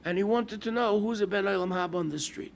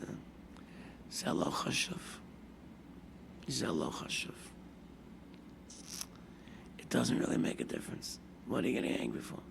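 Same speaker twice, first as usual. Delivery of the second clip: near-whispered, soft grieving husband; hushed grief; 6 semitones lower, 14 dB quieter than usual. Retold or imitated. Widower over his late wife, soft whispered grief. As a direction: let it drop to a broken hush.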